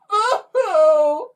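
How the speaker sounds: high loud crying wail; child's bawling howl; wailing sob. Acted. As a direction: burst into floods of tears. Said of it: "bursting into floods of tears".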